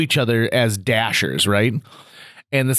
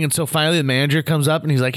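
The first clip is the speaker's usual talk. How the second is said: usual speaking voice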